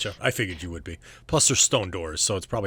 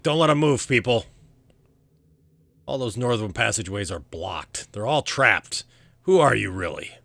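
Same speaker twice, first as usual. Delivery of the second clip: rally the guards and demand answers; loud commanding guard; 2 semitones higher, 7 dB louder than usual. Acted. Guard orders men, questions party.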